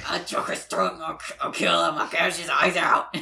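loud, hammed-up murderous rant; mock raging killer doll, piling on threats; Chucky. Host piling on Chucky's threats to the cop, loud mock rage. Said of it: "saying all these things that he wants to do to this guy".

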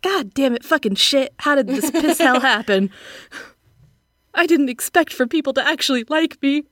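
half-joking annoyed grumbling; mock frustrated rant. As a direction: play up being fed up. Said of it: "You're like, "God damn it, now I gotta work".

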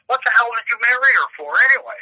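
high, incredulous retold question; mock exasperation. Joke coworker questions the groom's marriage, mock exasperated. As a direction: demand an explanation in disbelief, playfully.

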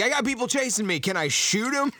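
high, pleading voice; a pleading henchman on the phone; mock panicked caller. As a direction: beg permission like a nervous kid. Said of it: "he's like".

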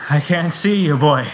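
high, loud in-character cry; injured father calling. Acted. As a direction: call out loudly, searching for your child.

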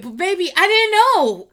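high, loud exasperated wail; exasperated cry. Real, not acted. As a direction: throw up your hands and cry it out. Pleading with fans, exasperated.

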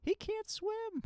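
very high, loud panicked mom, slowing on the repeat. Retold or imitated. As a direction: shriek it like a frantic mother. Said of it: "the mom freaks out".

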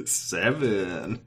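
low, breathy, seductive count; sultry. Retold or imitated. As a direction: purr the number.

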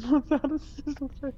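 laughing. Real, not acted. Joking about game plans.

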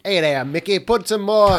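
hammed-up Boston accent calling to a pal. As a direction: play a broad mock Bostonian. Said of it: "that's not a good Boston accent".

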